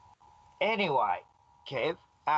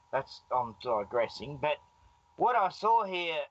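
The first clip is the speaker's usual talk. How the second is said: ordinary delivery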